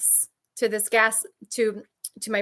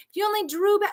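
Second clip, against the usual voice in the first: mock screaming abuser; 9 semitones higher, faster than usual. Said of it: "My narc would scream at me".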